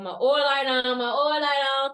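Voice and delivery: loud, drummed-out repeated nag; insistent reminder. Acted. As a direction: hammer the reminder home. Same reminder, now insistent.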